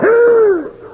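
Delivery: sharp, very high startled gasp; frightened gasp. Acted. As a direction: gasp as something brushes your foot. Something runs across his foot.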